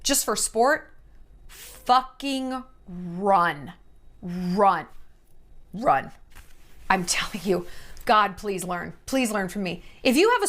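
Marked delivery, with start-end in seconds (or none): 1.5-6.1 slow, low, heavy run; urgent warning. Real, not acted. Warning viewers about liars, slow and heavy. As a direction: drag it out, dead serious.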